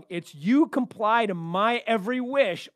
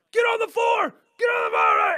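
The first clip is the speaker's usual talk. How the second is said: shouted police commands, high and loud; cops yelling; 13 semitones higher, 7 dB louder than usual. Retold or imitated. S1 retelling the cops yelling at the man. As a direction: bark it like a swat cop. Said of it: "and they're like".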